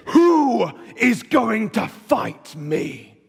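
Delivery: slow, drawn-out mock giant calling across a valley; a lightly booming Goliath shout; booming giant's challenge. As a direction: stretch it out like a huge warrior taunting. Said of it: "booming voice from across the valley".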